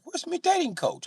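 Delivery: hammed-up high panic; panicked Brit. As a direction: call out lost in the crowd. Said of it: "He'd be like".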